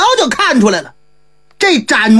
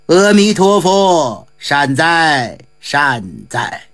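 monk's murmured prayer; 9 semitones lower, slower than usual. A narrator's reading. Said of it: "只能口中念了一句".

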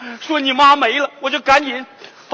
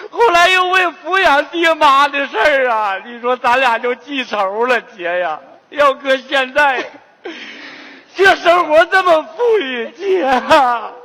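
sobbing, regretful lament; tearful confession. Acted. Brother apologising to his dying sister, tearful confession. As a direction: plead forgiveness through stagey tears, drawing out the ends.

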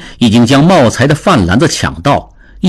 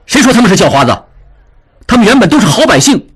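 higher, indignant rebuke; suddenly angry; 8 semitones higher, much faster than usual. A narrator's reading. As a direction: snap back in sudden anger. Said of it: "智庸突然生气地说".

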